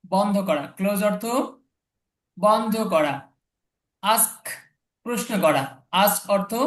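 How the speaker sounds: ordinary talk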